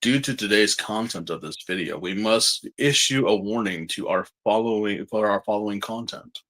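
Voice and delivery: low, formal announcer narration; narrator voice. Shown as a demonstration. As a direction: read it like a grave disclaimer announcer. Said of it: "let me try to do the narrator voice".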